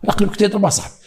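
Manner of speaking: ordinary delivery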